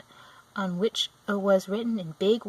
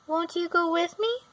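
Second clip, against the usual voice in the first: put-on higher pleading child; pleading girl; 10 semitones higher than usual. A narrator's reading. Dorothy pleads with the old woman to come, high and pleading. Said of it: "pleaded the girl".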